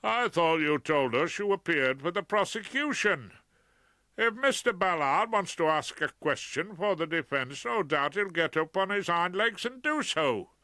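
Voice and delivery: high, loud, pompous judge; trumpeting judge. A narrator's reading. Judge scolding the barrister for helping the defence, booming. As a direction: boom it down from the bench. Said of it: "trumpeted from the bench".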